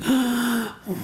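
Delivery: high amazed gasp; gasp of wonder. Retold or imitated. Neighbour girl at the fence, amazed gasp. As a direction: gasp like a wide-eyed girl. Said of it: "She went".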